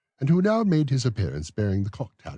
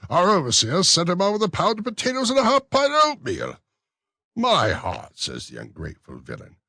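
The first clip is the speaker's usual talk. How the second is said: raised, fussy official's voice; pompous beadle; 8 semitones higher, 6 dB louder than usual. Acted. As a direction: play the self-important beadle. Bumble retells vagrant story.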